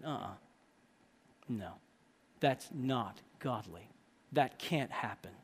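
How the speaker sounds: low, quiet, deliberate correction; stern rebuke. Acted. Brother grabbed by the scruff, low grave rebuke. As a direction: pull him up short, voice dropped low. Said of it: "You ever speak like that?".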